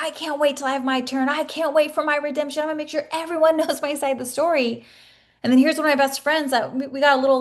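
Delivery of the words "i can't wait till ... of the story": higher-pitched, imitating her past self's hunger to be heard; mock the old impatient craving for revenge; eager for redemption. Retelling her old angry longing.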